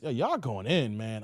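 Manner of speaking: usual speaking voice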